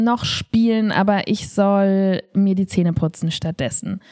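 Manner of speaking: usual speaking voice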